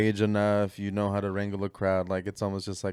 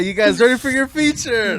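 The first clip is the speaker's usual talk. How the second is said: mock host voice; 14 semitones higher, 10 dB louder than usual. Imitating host, laughing.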